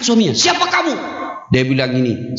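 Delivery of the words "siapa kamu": much higher, startled cry; startled question. Pretend. Husband frightened by the jinn, high startled cry. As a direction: gasp it out in fright.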